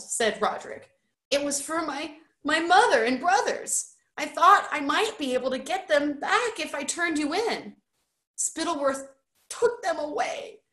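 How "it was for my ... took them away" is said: high-pitched, halting plea; upset pleading. A narrator's reading. Roderick explaining his family was taken, high and distressed. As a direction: plead as if close to tears.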